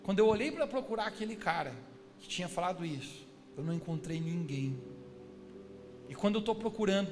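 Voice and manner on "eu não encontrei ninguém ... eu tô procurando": usual speaking voice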